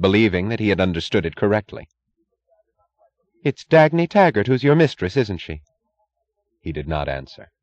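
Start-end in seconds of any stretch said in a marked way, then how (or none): 3.4-5.6 higher-pitched, pointed accusation; accusing wife. A narrator's reading. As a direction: confront your husband about his mistress. Wife confronts husband over affair.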